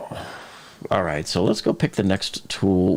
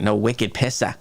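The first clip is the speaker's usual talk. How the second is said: light Boston accent on a slang phrase. Shown as a demonstration. Reading a viewer's comment about his missing accent, Boston accent. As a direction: read it with a touch of Boston. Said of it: "I don't hear the accent".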